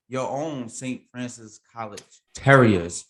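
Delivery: ordinary talk, nothing marked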